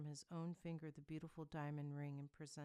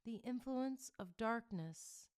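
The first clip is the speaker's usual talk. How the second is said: clear, ringing and slow; lifted, clear oracle tone; 6 semitones higher, 7 dB louder than usual. A narrator's reading. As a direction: pronounce each word clear and slow. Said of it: "the answer came in clear tones".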